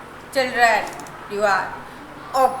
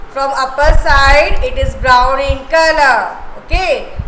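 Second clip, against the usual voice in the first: usual speaking voice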